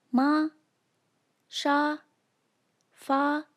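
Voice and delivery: "ma, sha, fa" each high and level; first tone. Shown as a demonstration. Teacher demonstrates the first tone, "ma, sha, fa" high and level. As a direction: hold "ma, sha, fa" on one high pitch from start to finish. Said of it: "maintains its pitch from start to finish".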